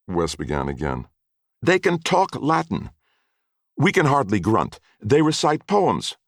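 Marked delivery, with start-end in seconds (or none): 1.6-6.2 anguished rant. A narrator's reading. Wesley banging his head against the wall, anguished high wail. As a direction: wail it out between the bangs.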